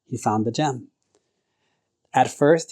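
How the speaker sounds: ordinary delivery, nothing marked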